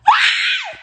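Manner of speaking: high, loud wailing cry with a laugh in it. Retold or imitated. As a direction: let out a sobbing half-laughing shriek. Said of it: "I started back crying because I'm just like".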